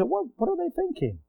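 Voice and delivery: high incredulous mock question; high-pitched imitated shock; mock disbelief. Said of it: "And you say".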